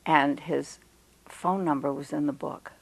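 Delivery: ordinary talk, nothing marked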